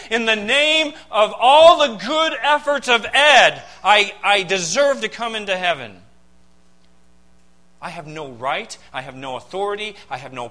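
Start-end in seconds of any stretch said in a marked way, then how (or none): 0.1-6.0 mock a proud sinner showing God his good works; pompous mock appeal to heaven; self-righteous boast. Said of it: "or come to God and say".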